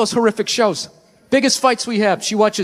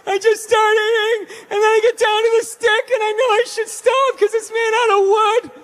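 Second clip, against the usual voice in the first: blubbering reality-show contestant; 10 semitones higher than usual. Retold or imitated. Reality-show dieter confessing on camera: mock blubbering whine. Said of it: "fat people cry about fudgicles".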